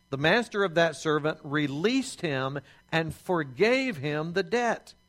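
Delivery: usual speaking voice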